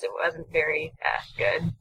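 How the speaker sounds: whispered, sheepish aside; quiet. Real, not acted. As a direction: mutter it under your breath, embarrassed. Sheepish comment on own bit.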